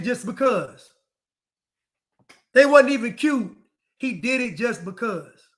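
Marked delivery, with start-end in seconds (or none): 2.5-3.6 loud. Real, not acted. Loud repeat.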